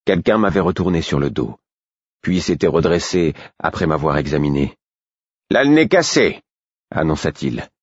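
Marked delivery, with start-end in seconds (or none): 5.5-6.4 raised announcing voice. A narrator's reading. Someone announces the broken nose.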